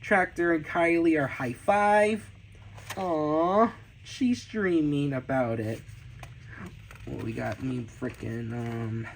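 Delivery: usual speaking voice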